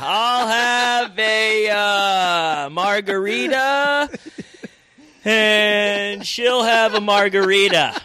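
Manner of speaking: high, very drawn-out caricature; mimicked oblivious customer. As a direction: stretch every word in a high mock voice. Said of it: "this guy just goes".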